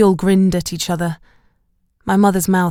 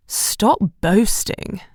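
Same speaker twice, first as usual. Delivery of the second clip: teasing Belle; 3 semitones higher, much slower than usual. A narrator's reading.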